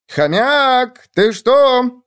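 high, stretched-out yell; drawn-out shout. A narrator's reading. Hare bursting in on the hamster, drawn-out high shout. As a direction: call it out loud, dragging the words. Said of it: "растягивая слова, закричал заяц".

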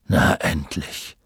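low, slow grumble; Chiron grumbling. A narrator's reading. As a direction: grumble it with relief. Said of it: "brummte Chiron".

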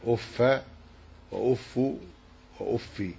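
أف said as uffa, uffu, uffi in turn; word-form demonstration. Shown as a demonstration. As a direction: recite أف with each short final vowel, separately. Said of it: "في أف عشر لغات".

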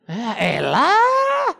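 drawn-out, loud, fed-up "elah"; exasperated groan. Retold or imitated. Child fed up with father's excuse, slow exasperated shout. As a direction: sigh it out like a disgusted kid.

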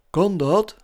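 hoger en luider, blij verrast; opgetogen vraag. A narrator's reading. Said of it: "vroeg Zeus opgetogen". (een vleugje opwinding)

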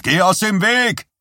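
high, loud warning yell; calling out. Acted. Hockey player yelling at the boy in the way. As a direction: bark it at the kid to get clear. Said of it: "rief jemand".

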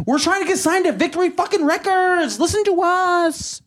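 high, strained mock pleading; hammed-up struggling local band begging to be heard; desperate wannabe band. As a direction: whine it drawn out, way up high.

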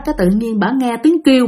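ordinary delivery, nothing marked

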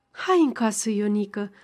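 ordinary delivery

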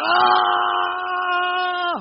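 sudden high shout of torment; anguished scream. Retold or imitated. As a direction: cry out in agony. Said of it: "la gente grita". (grito de angustia)

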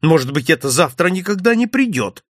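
worked-up questioning; agitated. A narrator's reading. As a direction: object anxiously, voice lifted. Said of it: "взволновался я".

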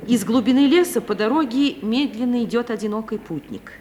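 usual speaking voice

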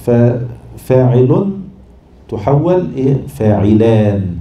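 "فاعلان" stretched very slowly to show the added sound; drawn-out demo. Shown as a demonstration. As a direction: drag out "ففاعل" and "فاعلان" so the extra consonant is heard. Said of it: "بدل فاعل". (مدّ "فاعلان" ببطء شديد لإظهار الحرف الساكن الزائد)

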